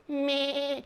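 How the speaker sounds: high bleating calf. Retold or imitated. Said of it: "that's not how cows do".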